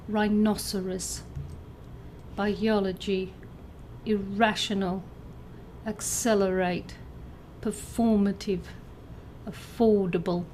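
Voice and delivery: "rhinoceros", "Biology", "irrational", "accelerate", "performative", "affordable", each stressed on its second syllable. Shown as a demonstration. Teacher reads out answers, second-syllable stress from "rhinoceros" to "affordable". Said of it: "either on the second syllable".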